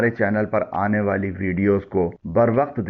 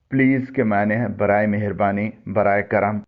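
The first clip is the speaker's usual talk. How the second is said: usual speaking voice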